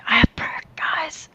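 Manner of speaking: whispered, soft ASMR version. Shown as a demonstration. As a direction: hush it into a whisper. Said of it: "gotta do it all in A S M R".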